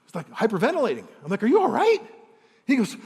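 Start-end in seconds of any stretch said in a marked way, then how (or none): 1.4-2.0 high and loud, startled; alarmed question. Retold or imitated. Boss startled by a panicked employee, alarmed. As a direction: jump in worried.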